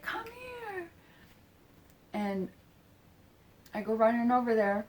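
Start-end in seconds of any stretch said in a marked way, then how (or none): 0.0-0.9 very high, soft imitated call; beckoning coo. Imitating the woman calling the speaker over, beckoning coo. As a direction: coo it high and softly, drawing it out.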